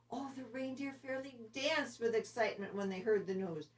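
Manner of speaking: plain reading voice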